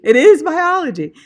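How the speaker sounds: loud and high, hammed-up insistence; emphatic loud retort. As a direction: bellow it back as a mock protest. Teacher repeats loudly, emphatic.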